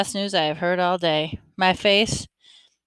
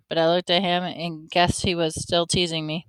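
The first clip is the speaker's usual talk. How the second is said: ordinary delivery, nothing marked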